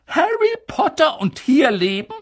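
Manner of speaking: high, loud outraged objection; indignant protest. Acted. Professor objecting to leaving Harry here, loud and outraged. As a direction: refuse it with indignation. McGonagall voiced.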